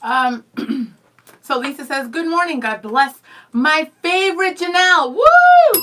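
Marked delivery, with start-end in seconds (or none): 2.1-5.1 playfully raised, louder gushing read; teasing gush, pitch up; mock misreading. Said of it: "You're not even gonna check?". 5.2-5.8 cheer. Real, not acted. Cheering herself after joke.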